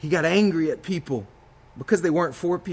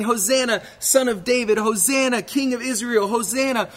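crowd shouting praise; 8 semitones higher than usual. Acted. Preacher acting the Jerusalem crowd, high excited shouting. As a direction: cry out like the welcoming crowd. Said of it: "They started shouting".

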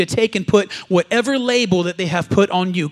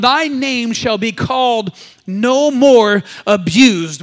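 slow, louder declaration; proclaimed out loud; 7 dB louder, much slower than usual. Real, not acted. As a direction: bellow it slowly with the church. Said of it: "one more time".

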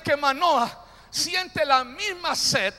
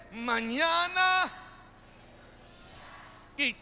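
slow, drawn-out call building to a loud finish; rising chant ending loud on oraré; louder, slower; slower than usual. Shown as a demonstration. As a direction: stretch each word, then hit tarde oraré loud. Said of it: "Otra vez, dígalo fuerte".

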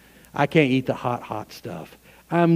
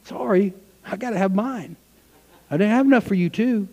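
higher, mock panicky guest; foolish virgin caught short; 7 semitones higher than usual. Pastor mocking the unready guest, hammed-up panic. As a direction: play the one who ran out of oil. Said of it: "Or will you be going".

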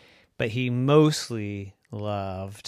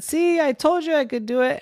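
high squeaky gloating; Roy crowing to the elephants, high and squeaky; triumphant mouse; faster than usual. Acted. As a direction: bounce it out proudly. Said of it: "Roy jumped up and down and said".

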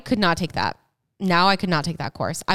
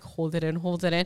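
self-soothing murmur; 5 dB quieter than usual. Retold or imitated. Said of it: "I was like".

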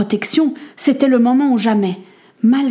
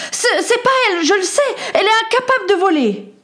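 put-on high, stammering boy's voice; high, urgent defence of a friend; desperate protest; 12 semitones higher, faster than usual. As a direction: plead her innocence in a rush. Boy protests friend's innocence.

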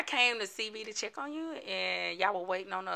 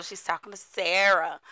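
ordinary talk

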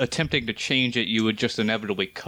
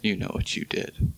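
whisper; 3 semitones lower than usual. Pretend. Mock accusation to a friend.

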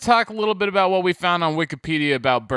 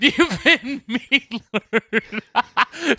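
laughing through a catchphrase; giggling the line out; 4 semitones higher, slower than usual. Real, not acted.

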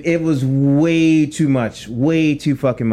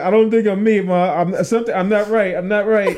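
higher, helpless confession to Mom; plead, laugh creeping in; mock panicked plea; 5 semitones higher than usual.

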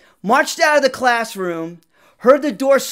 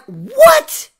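squeaky, much higher, loud startled yelp; shocked what; 10 dB louder than usual. Retold or imitated. Student hearing he must talk to the girl, high shocked yelp. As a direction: jump out of your skin on it.

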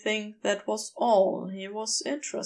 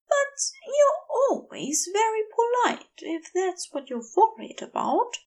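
lightly higher, gentle angel voice; Aziraphale voice; 11 semitones higher than usual. A narrator's reading.

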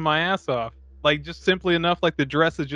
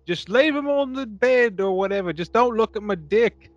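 mock Mick voice, much higher; hammed-up rugged Aussie hero; imitating Mick; 6 semitones higher than usual. Mick voiced in movie retelling.

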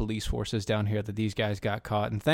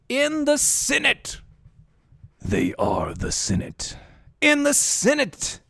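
mock senator voice; 12 semitones higher, slower than usual.

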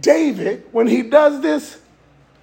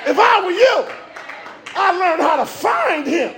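preaching at full force; 4 semitones higher than usual. Acted. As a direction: drive home each "If I were you". Preacher's repeated climax.

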